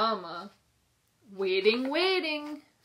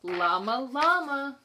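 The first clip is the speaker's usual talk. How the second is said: plain reading voice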